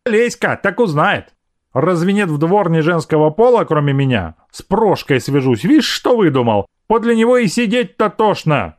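high-pitched, indignant maid; scolding maidservant. Acted. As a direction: scold him as an exasperated servant woman. (ворчливая служанка, высоким голосом)

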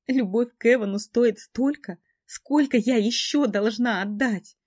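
high, loud, slow despair; desperate sobbing. A narrator's reading. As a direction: plead through tears. Heroine's desperate question.

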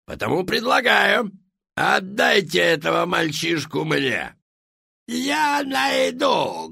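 loud, high, commanding villain; shouting. A narrator's reading. Villain demands the boy.

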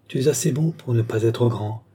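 usual speaking voice

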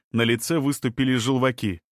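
ordinary delivery, nothing marked

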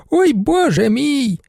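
high, slow dismayed cry. A narrator's reading. Baroness cries out in dismay before the judge. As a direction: wail it, turning away. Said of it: "крикнула вона".

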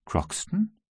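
soft, high, rising query; utter astonishment. A narrator's reading. Halliburtt hears his servant's name, softly astonished. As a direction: echo the name in hushed amazement. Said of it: "im Tone höchsten Staunens".

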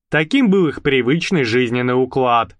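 plain reading voice

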